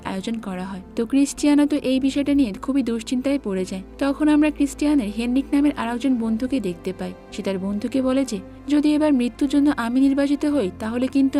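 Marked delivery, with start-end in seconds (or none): none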